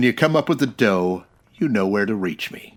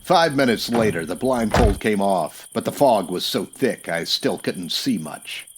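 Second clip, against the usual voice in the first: dry hard-boiled storyteller voice; noir narration; 3 semitones lower than usual. A narrator's reading. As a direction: tell it like a detective looking back in an old film.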